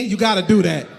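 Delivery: normal talking voice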